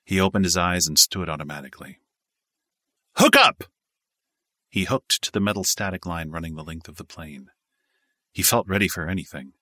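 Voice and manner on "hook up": barked order, much higher and louder; shouted command. A narrator's reading. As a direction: bellow it like a jump master. Jump master barks orders.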